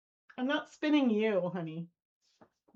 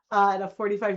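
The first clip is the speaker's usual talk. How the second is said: normal talking voice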